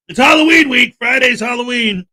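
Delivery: high loud Halloween cheer; excited announcement. Real, not acted. Host hyping the audience for Halloween week, loud and high. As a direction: sell it like big news.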